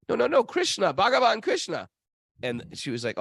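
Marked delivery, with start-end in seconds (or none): 0.1-1.9 high-pitched imitation of an insistent princess; insistent protest. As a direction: protest in a raised voice, correcting a father. Princess retold correcting her father.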